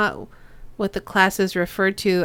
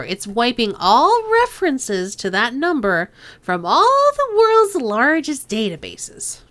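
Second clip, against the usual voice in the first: put-on Chang's nasal accent, selling her help; 6 semitones higher than usual. Boss Chang offering to burn their sins, nasal accent. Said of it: "to do Kindly Chang's accent".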